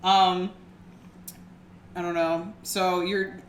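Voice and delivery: usual speaking voice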